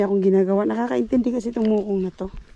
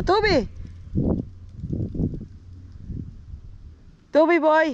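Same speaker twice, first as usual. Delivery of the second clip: calling out; 8 semitones higher than usual. Real, not acted. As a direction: shout the dog's name to find him. Calling out for lost dog.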